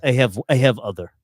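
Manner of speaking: put-on joking Russian-accented English; playful imitation of a Russian speaker. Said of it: "That's pretty spot on".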